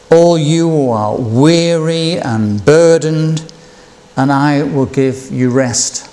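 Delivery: unhurried, tender reading of Jesus' invitation; preacher reading the words of Jesus to listeners, slow; slow scripture reading. Acted. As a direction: read each phrase slowly with pauses. Reads Jesus' words slowly.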